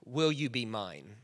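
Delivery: slow, soft asking; tender proposal. Shown as a demonstration. Pastor showing the congregation a tender proposal. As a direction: ask it gently, like a proposal. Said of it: "a better way to say it today would be".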